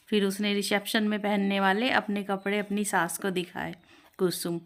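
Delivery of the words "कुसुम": ordinary delivery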